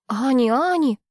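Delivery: mild alarmed calling; urgent worried call; panicking. A narrator's reading. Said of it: "почему-то запаниковала я".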